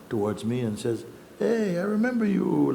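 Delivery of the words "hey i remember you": high, eager "I remember you"; mimicking a tourist's delighted recognition; excited recognition. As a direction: call it out like spotting an old friend. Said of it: "like this".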